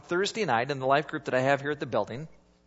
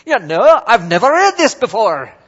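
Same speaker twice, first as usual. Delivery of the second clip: put-on loud quick Irish accent; friend's rapid Irish accent, loud; 5 semitones higher, 14 dB louder than usual. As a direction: rattle it off in a thick Irish accent. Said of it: "it's that thick accent, and he talks really fast".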